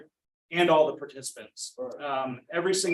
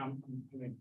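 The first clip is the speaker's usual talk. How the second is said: real emotion, much softer, words fading; emotional; 13 dB quieter, faster than usual. Real, not acted. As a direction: voice falling away as he chokes up. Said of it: "I'm getting emotional".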